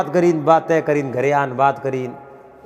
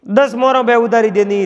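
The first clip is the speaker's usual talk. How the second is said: emphatic telling; 7 semitones higher, 5 dB louder than usual. A narrator's reading. Narrator stresses the ten coins lent.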